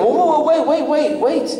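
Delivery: very high, rapid-fire comic urgency; acted high-pitched "hold on"; urgent calling back. Calling back boy walking away.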